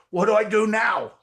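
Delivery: a thrilled, slightly frantic ask; excited panicked question. Retold or imitated. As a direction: call out like you struck gold. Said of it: "I go".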